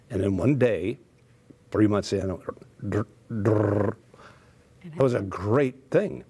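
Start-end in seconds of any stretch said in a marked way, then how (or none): 2.8-3.9 "drr, drr" with a rolled R trill; tongue trilling "drr". Shown as a demonstration. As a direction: roll the R hard in "drr". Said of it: "I couldn't make a trill".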